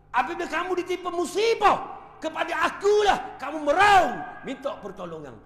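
loud, high preaching cry; preaching shout. Acted. Preacher shouting to the congregation about calamity. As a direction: boom it out to the congregation. Preacher booming Quran meaning.

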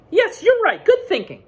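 very high and loud, eager; agreeing Duck. Acted. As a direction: agree brightly like a keen duck. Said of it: "agreed Duck".

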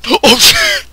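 high frightened yelp; scared shriek. Real, not acted. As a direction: shriek from a sudden jolt. Jump scare in game.

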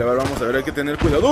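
usual speaking voice

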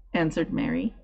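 usual speaking voice

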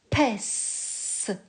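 "Pèse" whispered, the s hissed; whispered "Pèse" for a child; whispered dictation. Shown as a demonstration. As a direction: breathe out "Pèse" without voice. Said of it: "Tu vas écrire la syllabe".

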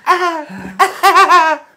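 high-pitched Californian girl imitation; Valley Girl. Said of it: "Read the joke in a Valley Girl voice".

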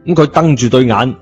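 plain reading voice

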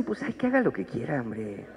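lightly voiced indifferent father-in-law; dismissive father-in-law. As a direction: wave it off like an unbothered father-in-law. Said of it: "los suegros dicen".

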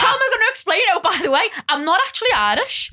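high, loud, laughing mock panic; mock flustered confession. Imagining confessing a fake nationality to a date, mock flustered. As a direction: play up the awkwardness, pitched right up.

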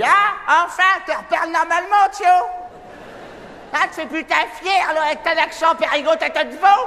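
mother's voice. Retold or imitated. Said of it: "mère, elle m'a dit".

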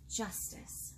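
breathy dramatic whisper; whispered reveal of the key word. A narrator's reading. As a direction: hush it like a secret.

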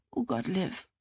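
soft, miserable groan of words; kläglich. Acted. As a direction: breathe it out, overwhelmed and sniffly. Weinende ist überfordert. (leise, verzweifelt)

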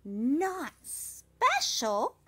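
the cricket crying out, amazed; astonished cry. A narrator's reading. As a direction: stretch it out in disbelief. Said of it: "cried the littlest cricket".

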